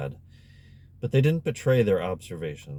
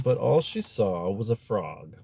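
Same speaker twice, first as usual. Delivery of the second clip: ordinary delivery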